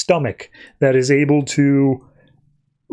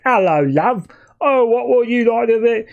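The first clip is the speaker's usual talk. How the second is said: high, hammed-up British accent; 9 semitones higher than usual. Dick greets his partner in character, mock British accent. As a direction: put on a cheery mock British voice. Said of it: "he's putting on his British accent".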